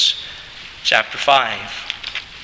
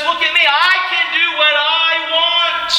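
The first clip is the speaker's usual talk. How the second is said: high, smug carefree voice; boastful libertine; 12 semitones higher than usual. Retold or imitated. Preacher mocking those who claim license, high and smug. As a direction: crow about doing as you please. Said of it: "Those who believed in license says".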